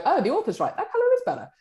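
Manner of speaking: high, bright mock concession; conceding publisher. Publisher conceding to the author in the meeting, high sing-song. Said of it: "Or we'll go".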